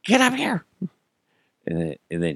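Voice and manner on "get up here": high-pitched strained holler; re-enacted yell, pitched way up; yelling for wife. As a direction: call out urgently to someone in another room. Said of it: "I yell for my wife".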